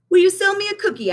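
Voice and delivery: put-on high, eager little boy voice; young Benny asking. A narrator's reading. Benny asks Miss Hill for a rose, high and eager. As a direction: ask sweetly like a small boy. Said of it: "asked Benny".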